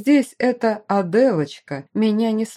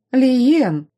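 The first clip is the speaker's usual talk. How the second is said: louder warning call of his name; 5 semitones higher, 6 dB louder than usual. Acted. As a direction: say Лиен as a stern warning. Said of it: "предостерегла она меня".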